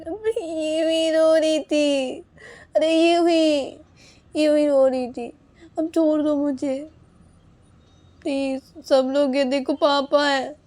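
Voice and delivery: high, loud, drawn-out whine; whiny protest. Acted. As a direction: stretch the words in pleading exasperation. Insisting she cried for nothing. (ऊँची, खिंची आवाज़ में झुंझलाकर मिन्नत)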